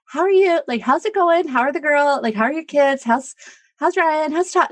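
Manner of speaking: bright, high, rattled-off greetings; high chirpy small-talk voice; breezy small talk. Retold or imitated. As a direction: rattle off polite questions in a high sing-song. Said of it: "It's always".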